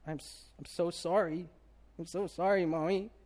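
put-on small, remorseful child voice; apologetic toddler. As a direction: imitate a little boy saying sorry to his mother. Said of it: "I saw his reaction to him".

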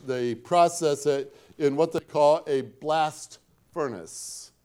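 ordinary talk, nothing marked

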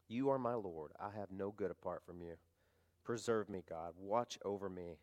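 soft, hushed reading of a psalm prayer; quiet prayer. Acted. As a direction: pray these words gently to God.

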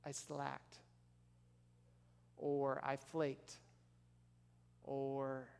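soft, subdued admission with long pauses; imagined confession. Retold or imitated. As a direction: own up quietly and haltingly. Models confessing to someone you let down.

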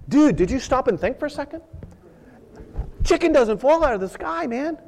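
high, fast exasperated crab voice; scolding crab. Acted. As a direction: talk sense into a fool. Another crab scolding the first.

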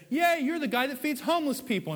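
high, bright mock cheer from admiring people; mock praise. Preacher mocking people who cheer the do-gooder, gushing high. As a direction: gush like a fan praising a do-gooder. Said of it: "So people say".

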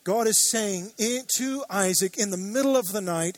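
ordinary talk, nothing marked

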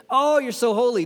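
breathless with wonder at God; overwhelmed awe. Pretend. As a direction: angels who can't get over it, awestruck. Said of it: "They're overwhelmed".

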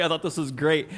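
ordinary talk, nothing marked

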